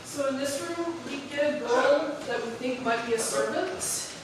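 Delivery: ordinary delivery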